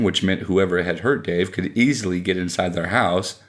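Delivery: plain reading voice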